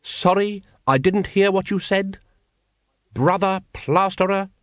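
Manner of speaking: a hint of a fussy cult leader scolding; pompous Grand Master. A narrator's reading. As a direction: scold the brethren like an irritated chief. Grand Master pressing for answer.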